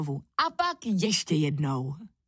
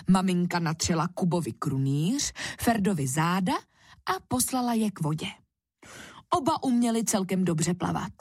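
plain reading voice

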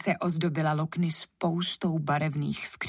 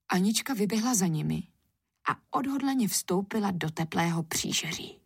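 usual speaking voice

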